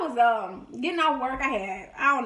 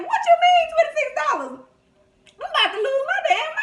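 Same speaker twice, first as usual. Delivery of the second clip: shocked outrage; 7 dB louder than usual. Retold or imitated. Retold outburst over empty account.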